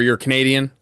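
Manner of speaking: normal talking voice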